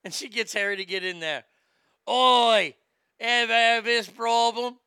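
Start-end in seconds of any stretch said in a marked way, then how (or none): 2.1-4.8 loud, higher Australian-sounding infomercial shout; botched British accent turning Australian. Acted. Harry opening an infomercial in the rowboat, loud Australian-sounding accent. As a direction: bellow it like a bad Australian-British pitchman. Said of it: "I know he sounds more Australian than anything".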